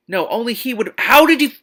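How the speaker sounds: very high, loud squeal; shrieking disbelief. Real, not acted. Reader, losing it over the plot, squeals very high. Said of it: "squealing disbelief".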